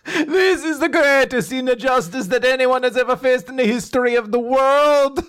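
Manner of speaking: high, hammed-up lament; over-the-top wailing injustice; mock anguish. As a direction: declaim it as tragic melodrama. Acted melodramatic outburst.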